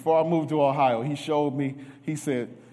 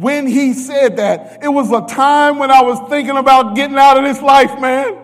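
building preaching; 11 semitones higher, 10 dB louder than usual. Real, not acted. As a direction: lift into preaching fervor. Preacher builds to full force.

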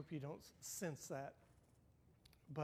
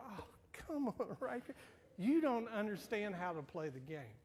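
laughing tease; 5 semitones higher, 4 dB louder than usual. Retold or imitated. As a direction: chuckle through the gentle complaint. Grandpa teasing grandson, laughing.

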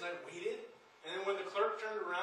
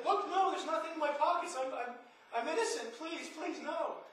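put-on higher, frantic little-boy begging; retold child pleading; 7 semitones higher than usual. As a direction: plead your innocence like a scared kid. Boy pleading with angry clerk.

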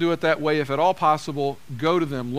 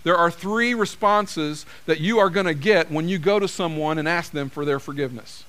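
usual speaking voice

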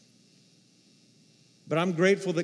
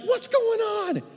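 high-pitched bewildered yelp; surprised squeak; slower than usual. Retold or imitated. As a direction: squeak it out in sudden disbelief. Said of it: "And I'm like".

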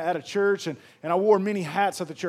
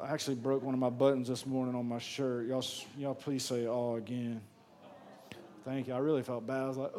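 much softer and lower, half to himself; sheepish aside; 6 semitones lower, 10 dB quieter than usual. Real, not acted. Pastor admits to the congregation he broke a shirt button, softly and sheepishly. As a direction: drop the voice for an embarrassed confession.

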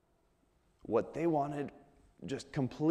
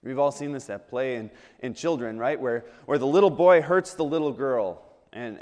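ordinary talk

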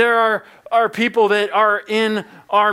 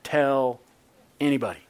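mild stern command, each word set apart; stern Jesus; 5 semitones lower, 3 dB quieter than usual. Acted. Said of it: "very sternly, the Bible says".

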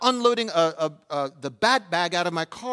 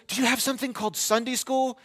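imitated curious boy, pitched higher; neighbor kid; 7 semitones higher than usual. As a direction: ask like a keen little kid, high-voiced. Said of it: "He goes".

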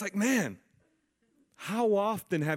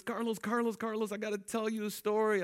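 higher, urgent calling of his name; eager little sister; 7 semitones higher than usual. Retold or imitated. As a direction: tug at his sleeve with your voice.

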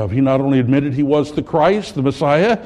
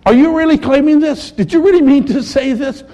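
high, loud, incredulous questioning; astounded disbelief; 12 semitones higher, 4 dB louder than usual. Acted. Leaders astounded, pressing Jesus for his claim: high, disbelieving. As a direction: pitch each question up in shocked disbelief. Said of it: "they're astounded".

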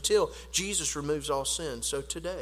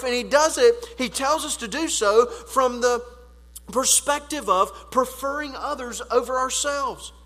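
loud, driving sermon delivery, higher in pitch; preaching at full force; 6 semitones higher, 10 dB louder than usual. Acted. Pastor urging his church to live the golden rule, preaching at full force. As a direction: preach it hard to the whole congregation.

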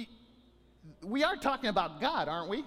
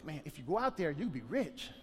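softer, lower tempting murmur; mock whisper; 6 semitones lower, 5 dB quieter than usual. Preacher voicing a tempter, softly murmuring the bribe. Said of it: "let me whisper it in your ear".

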